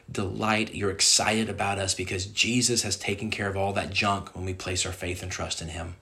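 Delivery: low, softened prayer after preaching; leading prayer. Real, not acted. Closes sermon leading prayer.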